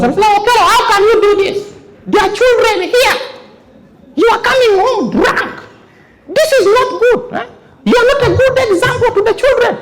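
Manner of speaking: very high, scolding mother voice. Acted. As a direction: scold the drunk husband like an angry mum.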